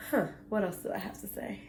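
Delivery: normal talking voice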